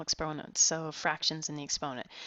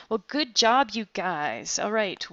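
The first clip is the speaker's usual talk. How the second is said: louder, cheerful good job; loud praise; 3 semitones higher, 10 dB louder, slower than usual. Real, not acted. Teacher praising students after the last example, loud. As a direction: congratulate the whole class.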